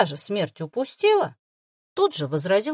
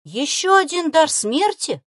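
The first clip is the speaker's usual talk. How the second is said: higher, louder, slow question; awed trembling delight; 8 semitones higher, 7 dB louder, slower than usual. Acted. Boy asks the girl about the fox, awed. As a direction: quiver with wonder. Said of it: "благоговейными нотками в дрожащем от восторга голосе".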